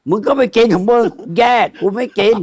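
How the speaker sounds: mock shouting in a bus quarrel; retold angry yell. Retelling a bus fight over pork, shouting back.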